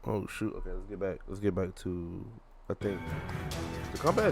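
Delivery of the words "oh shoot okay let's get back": usual speaking voice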